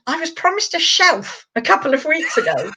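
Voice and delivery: high put-on posh voice; Spike. Bearded dragon complaining about a promised shelf, posh voice. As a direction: lodge a polite complaint in a posh accent. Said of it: "voice as being really posh".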